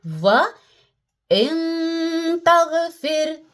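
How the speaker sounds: "व इन तगफिर" recited in a chant-like tune; recitation demo. Shown as a demonstration. Teacher reciting the example for students. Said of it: "अब आप कैसे पढ़ेंगे इसको?".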